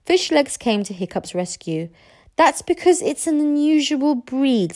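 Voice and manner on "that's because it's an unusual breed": put-on higher-pitched boy covering for his friend; eager young friend. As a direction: improvise an explanation to the chief.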